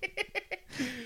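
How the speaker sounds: high-pitched laugh; squeaky laughing fit; high laughter. Real, not acted. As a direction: giggle up high at the joke. Laughing at own joke.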